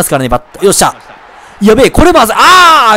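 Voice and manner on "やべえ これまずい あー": rising to a loud, high yell; panicked cry. Real, not acted. Batter fearing a double play, panicked cry. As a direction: blurt it out in alarm. Near disaster on a bunt.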